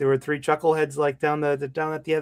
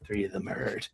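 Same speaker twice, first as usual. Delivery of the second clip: deadpan action hero; 6 semitones lower, 8 dB quieter than usual. Acted. Quoting a martial-arts movie.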